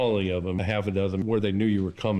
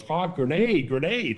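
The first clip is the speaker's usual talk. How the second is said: retold yell; 7 semitones higher, slower than usual. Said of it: "One of my guys yelled".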